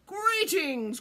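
high, loud regal greeting; crowing king. A narrator's reading. King greeting the runaway fox, high crowing voice. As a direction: crow it out proudly. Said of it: "crowed the king".

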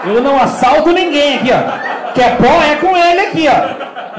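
shouted mock denial of guilt; loud mock protest. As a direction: protest loudly, as if wrongly accused in jest. Comic protest of innocence to audience.

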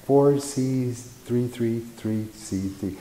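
slow, soft, drifting; trance-like recall. Retold or imitated. Hypnotized witness reciting the plate to the hypnotist, slow and hushed. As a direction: let each character surface slowly as if hypnotized.

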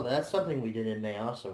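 usual speaking voice

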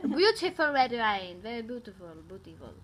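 mock French, fading to a murmur; French accent. Shown as a demonstration. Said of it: "The French accent".

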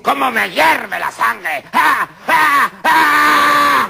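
boiling rage. Acted. Rival woman screaming in rage at the doctor. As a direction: let the rage boil over into shrieks.

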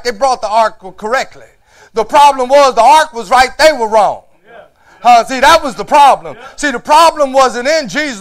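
loud, high preaching at full force. Acted.

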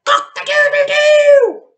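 very high, loud, slow crowing; cockerel squawk. A narrator's reading. The cockerel after the medicine, loud squawking crow. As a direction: crow like a startled rooster. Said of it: "squawked the cockerel".

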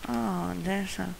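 normal talking voice

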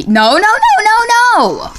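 rising run of high, loud noes; panicked cry. Real, not acted. Gamer falling in the quiz level, panicked cry. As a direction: panic as you lose control. Failing in the game.

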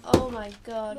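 ordinary talk, nothing marked

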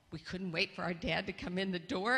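ordinary talk

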